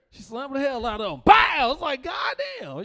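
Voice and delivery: loud high shouting; excited shout. Retold or imitated. Comic telling the slam to the audience, shouting high.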